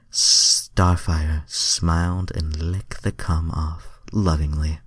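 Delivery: usual speaking voice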